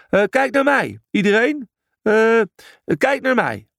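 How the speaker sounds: hoge, hakkelende roep; aandacht vragen. A narrator's reading. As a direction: roep hoog om ieders aandacht. Brutus vraagt ieders aandacht. (hoog hakkelend geroepen)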